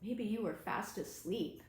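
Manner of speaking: usual speaking voice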